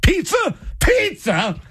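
shrieky, high-pitched indignation; mock escalating outrage. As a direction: imitate an incredulous hungry partner blowing up. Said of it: "And then just escalates".

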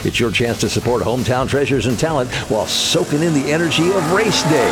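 punchy, rising promo voice; hype ad read. Acted. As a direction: sell the race night with energy. Reads a hype race ad.

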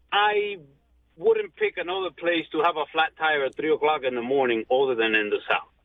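usual speaking voice

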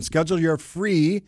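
"free" slowed and leaned on hard; stress on free. Shown as a demonstration. Host pitching listeners, leaning on "free". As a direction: drag out "free" to sell it. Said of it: "emphasizing the word free there".

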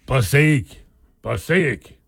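mock movie-chief voice, words set apart; old Western chief. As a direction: say Passaic like a hammed-up film chief. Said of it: "Do your Indian chief voice".

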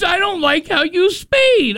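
a light mocking impression of the listener; mock fan complaint. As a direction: ham up the fan's complaint a little. Repeated fan complaint, higher.

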